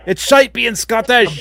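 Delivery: high-pitched Scottish outburst; Scottish accent. Acted. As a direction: burst out in broad Scottish. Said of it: "Love the Scottish".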